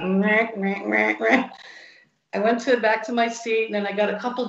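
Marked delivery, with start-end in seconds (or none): 0.0-1.5 mocking babble. Mocking Dion's refusal.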